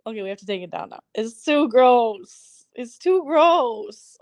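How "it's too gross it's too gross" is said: slow disgust. Real, not acted. Disgust at picture.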